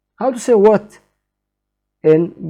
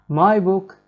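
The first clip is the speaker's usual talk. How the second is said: ordinary delivery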